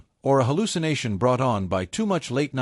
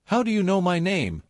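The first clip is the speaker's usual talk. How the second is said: stammering; 7 semitones higher than usual. A narrator's reading. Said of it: "he stammered".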